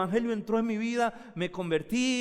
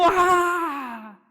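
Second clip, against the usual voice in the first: loud, high, slow demonic cry; preacher acting the demon who can't hide anymore; 7 semitones higher, 4 dB louder, much slower than usual. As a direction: let the demon howl at Jesus. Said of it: "Y empezó diciendo".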